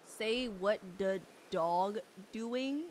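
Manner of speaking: very slow, word-by-word question; careful recitation. Shown as a demonstration. Streamer says a phrase on request for chat, slow careful recitation. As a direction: draw out each word carefully. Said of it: "Did I say it correctly?".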